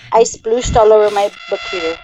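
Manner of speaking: ordinary talk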